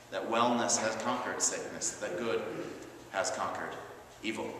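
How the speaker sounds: ordinary delivery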